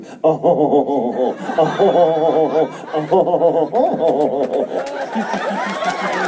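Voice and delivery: long high giggling fit; helpless laughter. Real, not acted. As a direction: laugh until breathless. Laughing at graveside idea.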